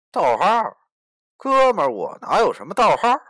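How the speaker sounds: loud, high disbelieving reply; startled protest. Acted. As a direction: blurt it out in alarm. Protagonist replies in panic.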